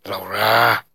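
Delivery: sharp snapped name. A narrator's reading. Daisy pulling her friend out, snapping at her. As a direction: snap the name in reproach. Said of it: "she snapped".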